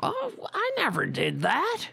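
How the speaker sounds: high, whiny mock denial; mocking innocent denial. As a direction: play dumb in a high voice. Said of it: "he acts like".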